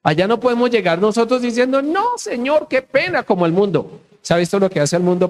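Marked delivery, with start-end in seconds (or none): 1.8-3.2 high-pitched embarrassed excuse; mock apologetic plea. Making excuses to the Lord on judgment day, mock apologetic. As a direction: plead sheepishly in a raised voice.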